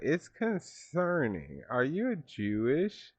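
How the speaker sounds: high, slow mock-child voice; mocking the kid. Vlogger mocking the student's words, high slow mock voice. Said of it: "what type of kid says that".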